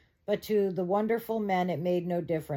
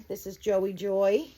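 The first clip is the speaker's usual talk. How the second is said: ordinary delivery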